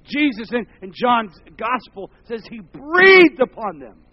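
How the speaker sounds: loud, high preaching cry; fiery preaching. Acted. Preacher crying out to the congregation, loud and high. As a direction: preach it out at full force.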